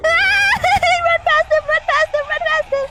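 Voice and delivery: high, loud, laughing panic; frantic while fleeing; panicking gamer. Real, not acted. Fleeing monster in game.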